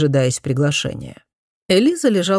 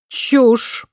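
higher, clipped dismissal; curt retort; 8 semitones higher, 3 dB louder than usual. A narrator's reading. Mother curtly dismissing her daughter's accusation. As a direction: cut her off in one word. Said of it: "отрезала леди Эленор".

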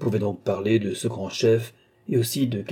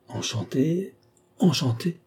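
higher, flustered stammer; 7 semitones higher, slower than usual. A narrator's reading. Athanase stammering to the captain, higher and flustered. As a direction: get the words out, rattled and thrown. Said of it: "balbutia Athanase".

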